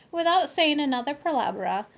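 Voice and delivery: ordinary delivery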